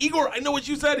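high-pitched eager outburst; giddy, high beginner's pride; excited. Acted. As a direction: show off to a friend, thrilled. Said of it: "behind the bar excited".

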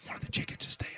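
urgent retold whisper; whispering bad news behind closed doors. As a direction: breathe it out secretly with no voice. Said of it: "She goes".

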